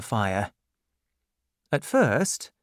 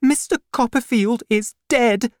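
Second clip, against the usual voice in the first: angry; 11 semitones higher, 6 dB louder than usual. Acted. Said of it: "said my mother angrily".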